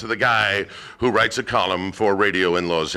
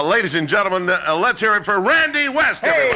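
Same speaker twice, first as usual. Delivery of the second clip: announcer intro; 8 semitones higher than usual. Real, not acted. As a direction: call the guest's name out big. Host introduces guest to audience.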